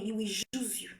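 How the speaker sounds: dropped almost to a whisper; hushed, ominous aside; hushed warning. Real, not acted. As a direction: lean in and say it low, like a secret warning. Warning repeated, first take hushed.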